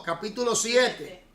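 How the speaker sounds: ordinary delivery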